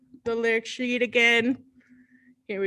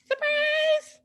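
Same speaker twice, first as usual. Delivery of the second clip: mock surprise; 13 semitones higher than usual. Teacher reveals hidden turtle.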